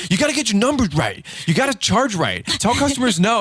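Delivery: high, punchy business-coach orders; mock tough guru; podcast boss voice. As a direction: lay down the law like a know-it-all host. Preaching business rules on the podcast.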